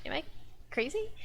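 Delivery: ordinary delivery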